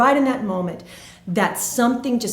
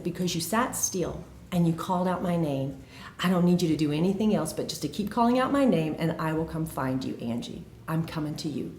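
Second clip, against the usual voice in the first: tender reassurance; 2 semitones lower, 5 dB quieter than usual. Retold or imitated. God promises to come find the searching woman, tender and soft.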